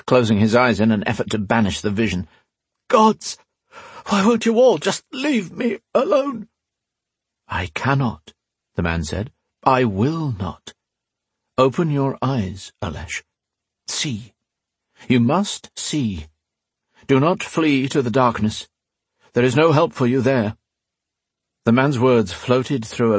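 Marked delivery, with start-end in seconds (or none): 2.9-6.5 anguished cry. Acted. Alesh crying out. 7.5-20.6 firm, patient urging; old priest. Acted. Priest insisting, slow.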